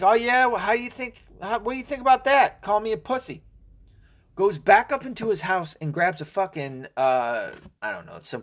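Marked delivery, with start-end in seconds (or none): 0.0-3.4 higher, mocking gloat; the shooter taunts the husband, higher mock gloat; shooter's taunt. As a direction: play the shooter sneering back. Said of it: "the guy's like".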